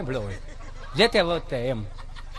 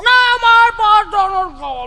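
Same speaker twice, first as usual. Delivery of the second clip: much higher, louder, slow whine; whiny child refusal; 12 semitones higher, 6 dB louder than usual. Retold or imitated. Spoiled son refusing his mother's Maggi, high slow whine.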